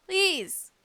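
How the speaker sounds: ordinary talk, nothing marked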